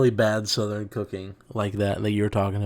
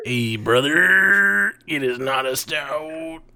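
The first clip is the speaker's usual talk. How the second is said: slow, drawn-out mock Southern twang; Southern accent, slow and mocking; mock southern drawl; 3 semitones higher, much slower than usual. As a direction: ham up a Southern good ol' boy, slowly.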